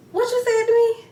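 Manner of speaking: high, loud, disbelieving yell; outraged shout. Pretend. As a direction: shout it back like you heard an insult. Outraged reaction to insult.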